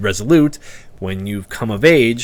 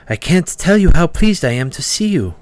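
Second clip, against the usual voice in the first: tiefling; 7 semitones higher, 3 dB louder than usual. Acted. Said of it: "says the tiefling".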